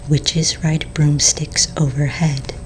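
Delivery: ordinary delivery, nothing marked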